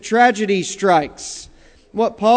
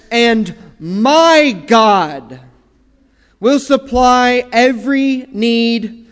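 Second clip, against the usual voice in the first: "my" in "And my God" drawn out slow and louder; stress on my; 4 dB louder, much slower than usual. Acted. Preacher quoting Paul to his congregation, stressing "my". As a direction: lean hard on "my" and stretch "And my God" out slowly.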